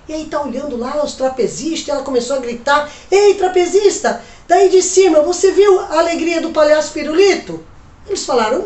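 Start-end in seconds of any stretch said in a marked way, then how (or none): 3.1-7.6 loud call to the trapeze; calling up high. Acted. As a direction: shout up to someone far above. Menina grita para o alto. (gritando para o alto)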